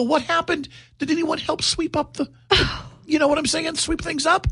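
high, incredulous string of questions; flustered questioning. Retold or imitated. Dad asking whether anyone helped sweep up, flustered. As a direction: pitch it way up, groping for words.

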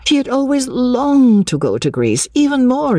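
high, gushing enthusiasm; carried away, voiced high; enthusiastic. A narrator's reading. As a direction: rush up high with longing. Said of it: "her enthusiasm".

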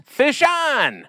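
a high, excited call of a hooked fish; excited yell. Acted. Ad voice calling out the fisherman's cry, high excited yell. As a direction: sing it out like you just hooked a salmon. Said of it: "the two words every fisherman loves to yell".

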